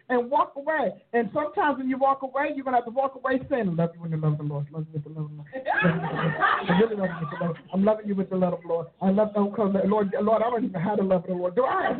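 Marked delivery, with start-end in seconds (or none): 3.7-12.0 lower, fast, forced loving voice; strained sweetness. Acted. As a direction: say it through forced sweetness, rushed.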